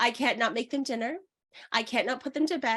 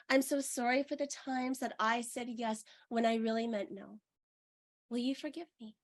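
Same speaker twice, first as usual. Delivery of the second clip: soft, hushed meditation voice; gentle meditation guide, softer; guided meditation; 6 dB quieter than usual. Acted. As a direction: speak gently, as if guiding a healing ritual.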